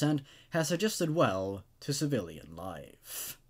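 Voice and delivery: low, even horror-story reading; story narration. A narrator's reading. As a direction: read it like a grim campfire tale. Reads horror story aloud.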